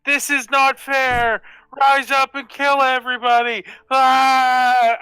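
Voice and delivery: high, slow agitator yelling; player's character riling up the crowd to riot; rabble-rousing shout. Acted. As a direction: stir up the mob.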